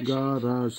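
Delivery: গারাজ drawn into two parts, ga-raj, softly; careful pronunciation. Shown as a demonstration. Teacher repeating the word for garage, carefully pronounced. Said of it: "উচ্চারণ হবে".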